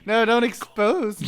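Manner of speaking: loud, laughing plea not to be exposed; laughing protest. Real, not acted. Caught out, playful protest.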